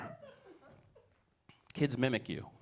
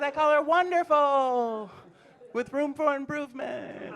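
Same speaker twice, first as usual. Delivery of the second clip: slow, drawn out; 9 semitones higher, slower than usual. Acted. Teasing his wife, slow and drawn-out. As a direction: stretch the praise slowly before the twist. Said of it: "wife jokes".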